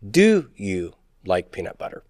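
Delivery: "Do you like peanut butter" softly, "Do" lifted, "butter" falling; question without rise. Shown as a demonstration. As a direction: lead with "Do", let "butter" sink low. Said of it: "I don't have to raise the intonation".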